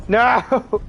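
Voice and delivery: high sharp cry of dismay; dismayed yell. Real, not acted. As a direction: yell it as your tank gets hit. Tank getting hit.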